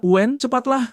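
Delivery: mock shout for Wen to hurry; urgent call. A narrator's reading. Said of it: "Seseorang berteriak".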